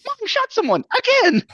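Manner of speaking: high-pitched, loud excited squeal; overjoyed, squealing high and loud; super excited kid. Acted. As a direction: shriek it like a thrilled child. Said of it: "super excited".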